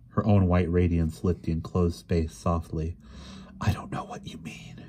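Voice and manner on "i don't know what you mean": soft, breathy whisper; barely voiced, dazed whisper; breathed whisper. A narrator's reading. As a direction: breathe it out under your breath. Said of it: "she breathed".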